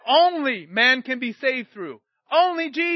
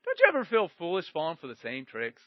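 ordinary delivery

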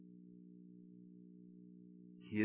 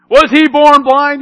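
loud interrogating; 10 semitones higher, 11 dB louder than usual. Retold or imitated. Leaders questioning parents.

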